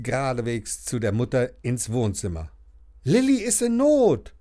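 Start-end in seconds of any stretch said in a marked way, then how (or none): 3.0-4.3 put-on higher, louder boy calling ahead; calling from afar. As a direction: call out to Mum from across the room. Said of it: "sagt er schon von weitem".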